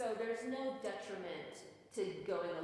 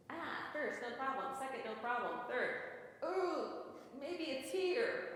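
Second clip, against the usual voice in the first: inner voice discovering; 6 semitones higher than usual. Retold or imitated. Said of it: "one little fingering passage that's like".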